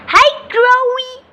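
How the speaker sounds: high, slow, squeaky little voice; sing-song baby bird hello; young bird greeting. Pretend. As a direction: greet like a tiny chick, drawn out.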